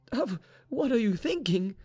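high-pitched indignant question; appalled cry. A narrator's reading. Mistress rejects her maid's plan, appalled cry. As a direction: protest in shock. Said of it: "cried Vaninka".

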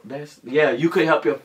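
usual speaking voice

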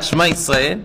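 normal talking voice

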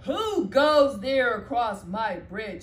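loud, slow troll challenge. Acted. Troll challenging the big goat, loud and slow. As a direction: bellow it out from under the bridge. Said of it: "said the troll".

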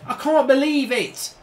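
normal talking voice

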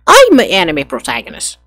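loud, high anime hero declaration. Acted. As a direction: announce yourself like an anime hero.

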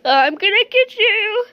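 crying threat. Pretend. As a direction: threaten through pretend tears. The owner chases the thief.

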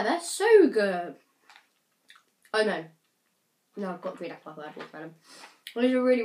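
sucking candy while talking; candy in mouth. Real, not acted. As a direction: sour grimace while speaking. Seen 3: candy put in mouth before; sucks it, sour grimace, speaks.

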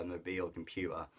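ordinary talk, nothing marked

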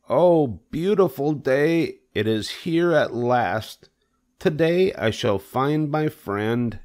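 high, slow, rapturous pig voice; eager Wilbur. Acted. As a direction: greet the day in a high, drawn-out voice.

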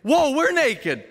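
high, loud startled whoa, slowing; mock shock. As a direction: gasp it out in surprise. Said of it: "She said".

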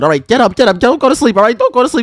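normal talking voice

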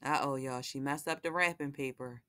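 ordinary delivery, nothing marked

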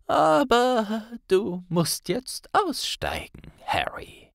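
put-on slow, airy old wizard voice; old headmaster gently insisting; gentle Dumbledore. A narrator's reading.

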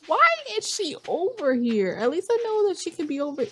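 high-pitched exasperated disbelief; incredulous outburst. Real, not acted. Player baffled at character being over here, incredulous. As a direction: protest it high, voice jumping up. Shocked at game character intruding.

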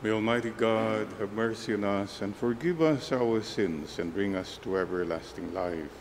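ordinary talk

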